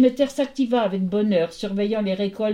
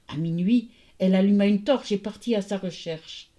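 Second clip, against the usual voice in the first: ordinary delivery, nothing marked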